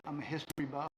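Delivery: normal talking voice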